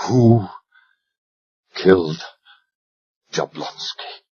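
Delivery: low hushed cold question; asked quietly. Acted. Talbot interrogates the dying Royal, quiet and cold. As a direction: ask it almost in a whisper. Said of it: "I asked quietly".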